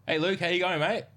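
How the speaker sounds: high, loud cheery hello; energetic greeting. Retold or imitated. Gym manager greeting a client, energetic. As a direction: greet him with bouncy energy. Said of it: "be very energetic".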